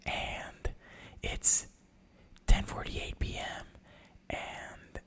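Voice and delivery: hushed whisper so neighbors sleep; breathy late-night whisper. Shown as a demonstration. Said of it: "this episode to be fully whispering".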